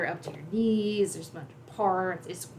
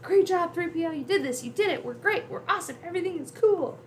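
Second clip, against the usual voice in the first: high, elated mock yelling; Luke yelling excitedly; 10 semitones higher than usual. Praising C-3PO once the walls stop, excited yelling. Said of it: "Luke's yelling".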